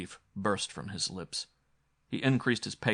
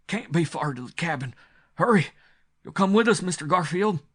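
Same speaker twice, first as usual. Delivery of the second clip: frightened urging; 9 semitones higher, 8 dB louder than usual. A narrator's reading. Frightened man urging speed.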